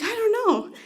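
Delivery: squeaky-high retold reply; high-pitched cluelessness. Wife answers husband whether cat is dead, high and clueless. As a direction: pitch it way up, helplessly unsure.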